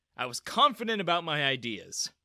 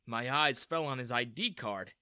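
plain reading voice

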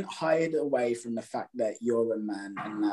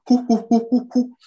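high, loud monkey hooting; monkey hoots; 12 semitones higher, 6 dB louder than usual. Retold or imitated. Imitating a monkey to the listener, loud high hoots. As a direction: hoot like a chimp, up high. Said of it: "you would start going".

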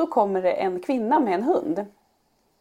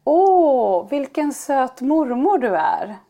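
slow, higher, sweet compliment; sweet kid voice; 4 semitones higher, 4 dB louder, much slower than usual. Retold or imitated. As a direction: gush slowly like a kind child. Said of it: "säger Frans då till den här kvinnan".

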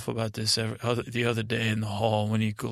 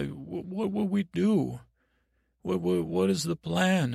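higher, stammering, slightly worried voice; anxious boy; 6 semitones higher than usual. Acted. Prisoner boy asks Tommen about his trial, anxious and higher. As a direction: stumble anxiously over the question, pitched up.

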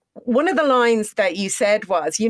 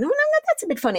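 puzzled; faster than usual. Retold or imitated. Retelling own worried thoughts.

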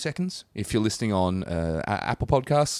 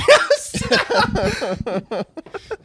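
high laugh; 7 dB louder than usual. Real, not acted. Laughs at own insult.

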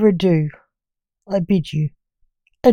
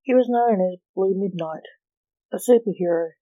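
usual speaking voice

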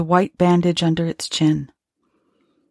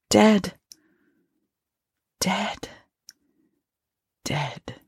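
fading despair; 2 semitones higher, 4 dB quieter, much slower than usual. A narrator's reading. Narrator finds wife's corpse dead, fading despair. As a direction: let the word sink away to almost nothing.

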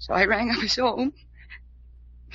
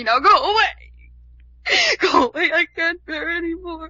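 tearful cry; 5 semitones higher, 5 dB louder than usual. Acted. Tearful begging.

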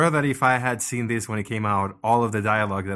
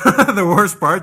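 louder, slow, through a laugh; laughing emphasis; 8 semitones higher, 12 dB louder, slower than usual. Real, not acted. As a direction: lean in hard while laughing. Repeats phrase with more force.

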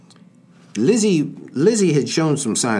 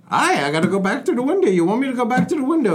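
hammy high fast Irish brogue; overdone Irish maid voice; bad Irish accent; 13 semitones higher, faster than usual. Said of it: "It's the worst Irish accent I've ever heard".

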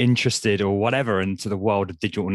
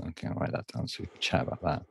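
half-whispered, much softer note to self; muttered aside; 5 semitones lower, 11 dB quieter, faster than usual. Real, not acted. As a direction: mutter it while typing. Host typing, aside to himself.

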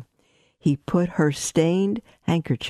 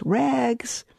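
high, ringing ragman's cry; street vendor's call; 10 semitones higher than usual. Acted. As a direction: call out like a peddler down the alley. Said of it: "he was calling in a clear voice".

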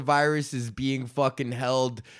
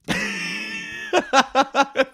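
high shrieking laugh. Real, not acted. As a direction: burst of squealing laughter. Cracks up at headline.

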